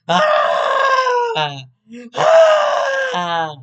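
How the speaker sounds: very high, loud, drawn-out scream; mock child scream. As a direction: imitate a kid shrieking on a plane. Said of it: "hearing a child screaming".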